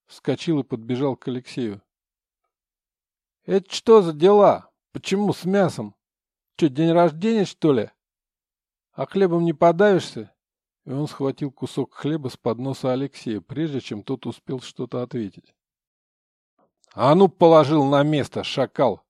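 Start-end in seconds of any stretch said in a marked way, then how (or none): 3.5-10.3 loud, higher, needling prisoner; jealous squabbling inmate. Acted. Щуплый Витяй наезжает на Алексея. 16.9-19.0 loud, authoritative bark; commanding. Acted. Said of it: "властный голос Бори".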